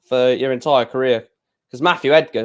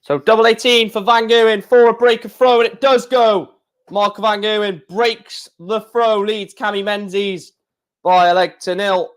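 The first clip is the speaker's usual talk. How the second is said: raised, louder darts commentator call; mock commentary; 7 semitones higher, 3 dB louder than usual. Streamer calls the darts leg for viewers, commentator style.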